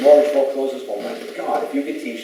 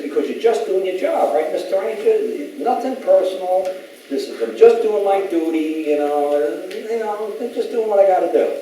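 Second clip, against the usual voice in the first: high, mocking sing-song; mocking impression; 11 semitones higher than usual. Man mocking the ranger's excuse in a high voice. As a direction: needle the ranger with a high mock voice.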